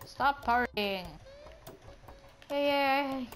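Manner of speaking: usual speaking voice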